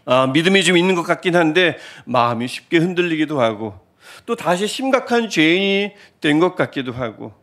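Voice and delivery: normal talking voice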